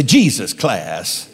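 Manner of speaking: slow, drawn-out "Jesus class" with a head shake; slow, knowing "Jesus class"; head-shaking emphasis. Shown as a demonstration. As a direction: savour "Jesus class" like it's top quality. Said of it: "kinda shake your head".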